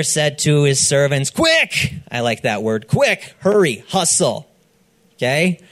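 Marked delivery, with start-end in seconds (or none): 1.3-1.9 sudden high urgent cry of quick; urgent call. Acted. Father urging his servants to hurry, excited high call. 2.9-4.5 urgent. Acted. Father urging servants to hurry.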